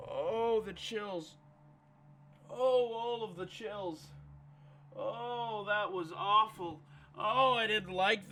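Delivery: soft, breathy aftershock; quiet shudder after a fright; shaken and hushed. Real, not acted. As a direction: get the chills out. After the scare.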